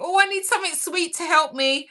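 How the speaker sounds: craving inner voice. Retold or imitated. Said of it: "and I think".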